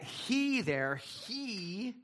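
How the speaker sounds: "he" drawn out long and slow; emphatic HE. Shown as a demonstration. Preacher pointing out the Greek to the congregation, emphatic "he". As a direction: lean hard on "he", stretching it. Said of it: "is emphatic in the Greek".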